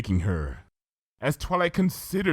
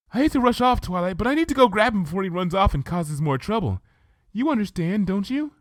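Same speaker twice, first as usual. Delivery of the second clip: much higher, hurried and sweet; apologetic Fluttershy; 11 semitones higher than usual. Acted. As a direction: excuse yourself kindly in a rush. Fluttershy voiced in story.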